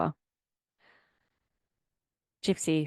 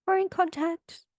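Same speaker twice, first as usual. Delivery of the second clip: much higher mock-casual dude voice; mocking boyfriend; 11 semitones higher than usual. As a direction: lampoon him telling her to chill. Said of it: "He's like".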